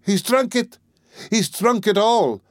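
very high, despairing wail, slowing at the end; wife screaming that husband drank it all, despairing; screamed in despair. A narrator's reading. A wail of despair. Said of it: "the poor woman screamed in despair".

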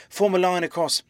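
high, urgent command; crying out. A narrator's reading. Hunted man organises the crowd, high urgent cry. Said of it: "he cried".

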